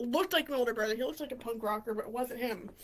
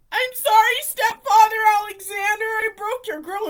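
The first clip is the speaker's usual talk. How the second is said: high, raised retold apology; loud confession; 6 semitones higher, 10 dB louder than usual. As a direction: re-enact blurting out an apology.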